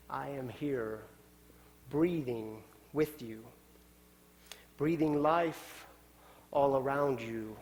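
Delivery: a hushed, tender call to join in; gentle voice of the Spirit. Pretend. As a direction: speak as the Spirit, close and unhurried. The Spirit speaking to the congregation.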